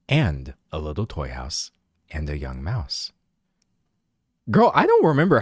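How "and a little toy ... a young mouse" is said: low, soft bedtime-story narrator; hushed, low storybook reading; audiobook voice. Shown as a demonstration. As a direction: read it like a chill audiobook narrator. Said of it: "I can literally do the audiobook voice".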